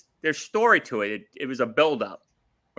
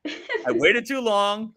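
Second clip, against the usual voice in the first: slow, higher, stretched-out complaint; drawn-out lament; 8 semitones higher, much slower than usual. Real, not acted. Fan complaining about a long wait, slow lament. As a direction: drag each word out in exasperation.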